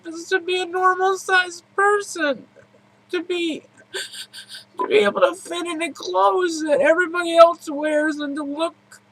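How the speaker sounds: high, breaking voice through tears; sobbing out a lifelong wish; crying. Real, not acted.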